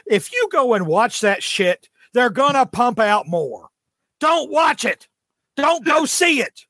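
slow, emphatic exasperated rant; exasperated warning. Real, not acted. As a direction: scold the audience, drawing out the warning. Ranting warning at the audience.

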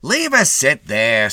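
cocky attendant. A narrator's reading. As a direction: take over with insolent ease.

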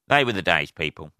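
normal talking voice

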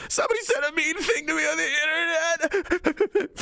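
high, whiny mock of a hurt troll; mocking weak person. Host mocking a thin-skinned troll, whiny and high. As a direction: whine it like a wounded crybaby. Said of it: "inside the mind of a weak person".

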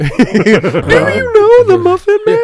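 tiny high squeaky cookie voice; gingerbread man voice. Retold or imitated. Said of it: "he sounds more like the gingerbread man".

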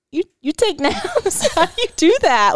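high, slow, incredulous; mock amazement. Parents marvelling at kids who nap, high and amazed. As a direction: gasp it out in disbelief. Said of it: "We were like".

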